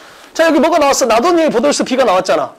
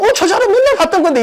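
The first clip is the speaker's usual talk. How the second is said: high-pitched student realizing it's familiar; mock surprise at seeing familiar material; acted recognition; 7 semitones higher than usual. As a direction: imitate a student's sudden recognition. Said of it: "어떤 느낌이 나야 되냐면".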